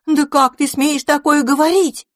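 возмущённо, выше и громче; отчитать брата в сердцах. A narrator's reading. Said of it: "возмутилась Пипина". (гневное возмущение, громко)

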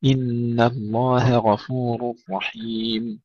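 إن الله غفور with thin h, thick gh, slowly; light ha, heavy ghayn. Shown as a demonstration. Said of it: "ترقيق الهاء وتفخيم الغين". (إن الله غفور بهاء مرققة وغين مفخمة)

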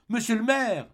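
high, loud call of alarm; desperate cry. Acted. Fantine from her sickbed cries out to the mayor, high and loud. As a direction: cry out as if the world is collapsing. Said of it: "cria Fantine".

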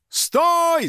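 high drawn-out halt shout; yelling. Acted. Second guard ordering the fugitive to halt, yelling. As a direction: bellow it, high and urgent. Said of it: "заорал второй".

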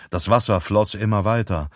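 usual speaking voice

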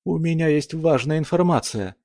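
put-on high nervous young voice; nervous youth swallowing hard; нервно, сглатывая. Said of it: "громко сглотнул Кирилл". (нервно, с комом в горле)

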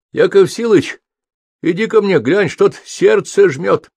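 high, loud call for help; booming patient's call. A narrator's reading. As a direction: holler plaintively across the ward. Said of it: "Зычно пробасил тощенький мужичок". (громкий жалобный оклик больного)

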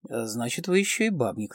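ordinary delivery

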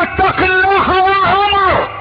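high, slow, ringing call to fear God; bold rebuke. Retold or imitated. Man rebuking caliph Omar, high and slow.

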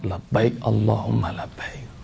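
slow, soft talbiyah; hushed devotion. Retold or imitated. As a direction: murmur it slowly, as if overcome. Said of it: "dengan suara ini". (lirih, pelan dan khusyuk)

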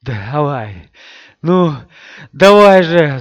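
loud breathless urging, gasping; out of breath. Acted. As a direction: gasp it out, swallowing syllables. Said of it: "задыхаясь, сказал Мэтт, глотая слоги".

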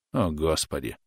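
soft, low exasperated sigh; weary sigh. A narrator's reading. Son sighing at his mother's jab, soft and weary. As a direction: let it out on a tired breath. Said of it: "вздохнул Рудольф".

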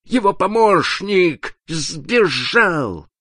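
high, slow, drawn-out alarmed cry; narrator's high-pitched horrified woman; cry of horror. A narrator's reading. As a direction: cry it out in horror, stretching each word. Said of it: "Крик ужаса, вырвавшийся у молодой женщины".

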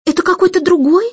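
high, quick startled asking; puzzled question. A narrator's reading. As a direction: wonder aloud, fast and high. Jane puzzled about kite.

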